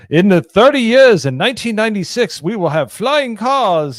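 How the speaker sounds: put-on old newsreel announcer, high and loud; booming Mid-Atlantic accent, slowed on the end. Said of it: "with the Mid-Atlantic accent".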